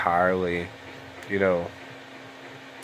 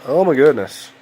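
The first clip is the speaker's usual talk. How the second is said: louder, higher oh no of dismay; dismayed outburst; 6 semitones higher, 7 dB louder than usual. Real, not acted. Gamer reacts as his keyboard acts up, dismayed. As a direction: react to something breaking, loud.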